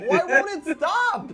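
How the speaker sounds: slow laughing plea, high; laughing in distress. Real, not acted. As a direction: laugh out the complaint. Suffering from sour candy.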